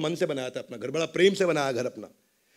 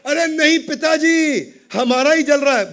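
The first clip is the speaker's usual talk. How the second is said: alarmed correction; 10 semitones higher, 11 dB louder than usual. Acted. Son corrects father in alarm.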